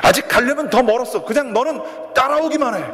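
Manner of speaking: rising to a high, pushing call; coach's urging shout. Retold or imitated. Coach to blindfolded crawling student, high urging shout. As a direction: urge him on like a coach shouting. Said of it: "계속해서 소리를 칩니다".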